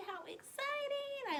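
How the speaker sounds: high, slow gush of delight; excited. Real, not acted. Teacher to children about Easter, high and thrilled. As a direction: sound thrilled that Easter's coming.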